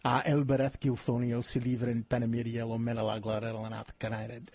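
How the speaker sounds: murmured Elvish chant recited from memory; reciting Elvish. Shown as a demonstration. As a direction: softly recite the Elvish verse. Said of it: "I did used to speak, uh, Elvish".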